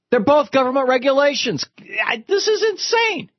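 can't believe what you're hearing; exasperated. Real, not acted. Host exasperated at the argument.

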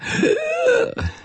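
high, exaggerated gasp; theatrical intake of breath; mock gasp. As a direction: gasp in pretend shock. Mock reaction to religious segment.

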